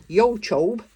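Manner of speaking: exaggerated Yorkshire vowels, said loudly; Yorkshire accent. Shown as a demonstration. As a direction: put on a Yorkshire accent, punched out. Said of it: "that's my Yorkshire accent there".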